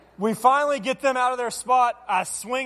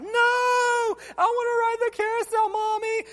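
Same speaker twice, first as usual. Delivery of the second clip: put-on very high, slow, crying kid's wail; child's crying fit; 13 semitones higher, slower than usual. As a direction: wail it like a boy in a meltdown. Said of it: "He starts freaking out".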